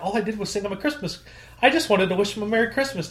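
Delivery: high, sweet feigned innocence; mock innocence. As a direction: play dumb, all innocent.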